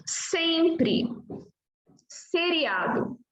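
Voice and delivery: "Sempre" and "Seriado" opened on a clear voiceless sss; initial S sound. Shown as a demonstration. Teacher gives examples of S at word start, voiceless S. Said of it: "Exemplo do fonema S no começo".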